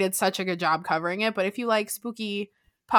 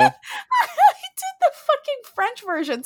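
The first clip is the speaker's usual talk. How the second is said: high, slow squeal through laughter; helpless laughing; slower than usual. Real, not acted. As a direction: laugh at your own blunder as you speak. Laughing at own language slip.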